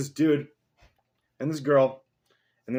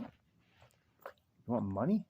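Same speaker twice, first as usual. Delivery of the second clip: very slow, stretched, quieter "You want money?" with a rising end; drawn-out tease; 3 dB quieter, much slower than usual. Pretend. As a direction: drag every word of "You want money?" out to tease the dog. Same question repeated to dog.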